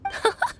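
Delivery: short, high little laugh; amused giggle. Acted. Amused at child's confusion.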